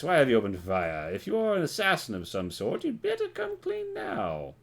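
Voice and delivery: high, sweeping official voice; high-pitched stern inspector; interrogating officer. Acted.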